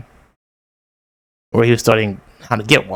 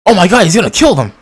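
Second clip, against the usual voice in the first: high, loud panicked outburst; alarmed shout; 8 semitones higher, 7 dB louder than usual. Real, not acted. Viewer panicking as a killer appears, alarmed shout. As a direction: cry out in real alarm at the screen.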